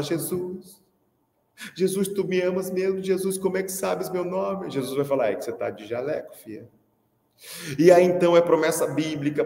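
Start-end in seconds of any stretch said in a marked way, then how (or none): 0.0-4.7 a touch of high, tearful awe; moved teacher. Pretend. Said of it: "você emocionado fala". 5.3-6.7 lower, softer, matter-of-fact; deadpan low punchline. Pretend.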